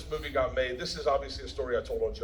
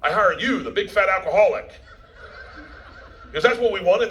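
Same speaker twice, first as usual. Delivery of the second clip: louder emphatic mock rant, laughing; producer's rant; 5 semitones higher, 6 dB louder than usual. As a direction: hammer it home like the boss who hired a drunk. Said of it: "And he goes".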